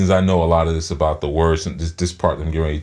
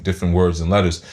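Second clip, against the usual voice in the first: ordinary talk, nothing marked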